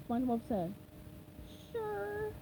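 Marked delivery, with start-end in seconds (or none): 1.7-2.3 sad. A narrator's reading. Disappointed SpongeBob.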